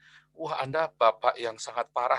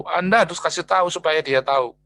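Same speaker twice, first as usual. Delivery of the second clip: ordinary delivery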